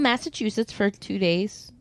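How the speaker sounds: ordinary delivery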